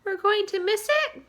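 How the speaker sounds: high excited shout; the ladybug shouting as the peach nears the rainbow. Acted. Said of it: "shouted the ladybug".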